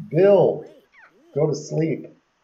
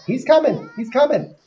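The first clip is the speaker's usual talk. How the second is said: excited; 9 semitones higher, 4 dB louder than usual. Real, not acted. Excited a sim arrives.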